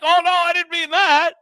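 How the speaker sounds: high, loud whiny mock denial; mocking Clapper. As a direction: squeal it like a caught liar. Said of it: "he's like".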